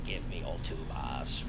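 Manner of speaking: low, soft mock threat, sinking to a whisper; half-whispered shakedown; mock demand. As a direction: growl a demand for the bars, then whisper it. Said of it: "and I'm like".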